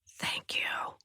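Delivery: soft breathy whisper; whispered thanks. A narrator's reading. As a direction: breathe it out gratefully. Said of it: "I whisper".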